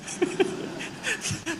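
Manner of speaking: a high breathy burst of laughter. Real, not acted. Preacher laughing at his own joke about Rumi. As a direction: laugh at the punchline.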